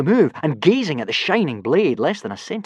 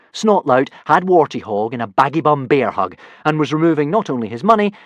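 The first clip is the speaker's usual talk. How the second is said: plain reading voice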